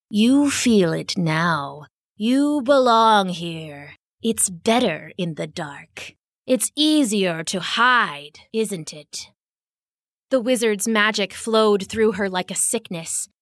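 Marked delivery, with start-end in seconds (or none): 0.1-9.3 slow, menacing wizard voice, slightly lower; a dark wizard luring his prey, slowly; sinister wizard. Acted.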